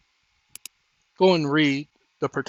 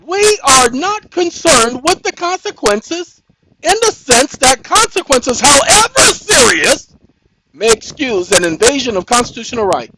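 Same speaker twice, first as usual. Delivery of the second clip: outraged quoting; 8 semitones higher, 8 dB louder than usual. Real, not acted. Quoting the Supreme Court to his viewers, high, loud and outraged. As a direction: read the court's words back in disbelief. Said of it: "these are their words in this case".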